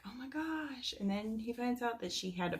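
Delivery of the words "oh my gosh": a soft, quiet "oh my gosh", pitched higher; mock stunned hero, hushed; hushed surprise. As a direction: lightly imitate his stunned gasp. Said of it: "and he's like".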